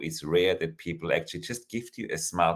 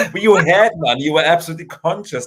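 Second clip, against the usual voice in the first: laughing; 12 semitones higher, 12 dB louder than usual. Real, not acted. Laughing reply.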